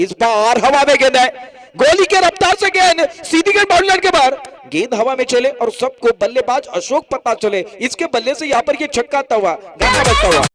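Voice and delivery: high, loud roaring play-by-play; excited cricket commentary. Real, not acted. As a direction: call the six at the top of your voice. Live six called at full pitch.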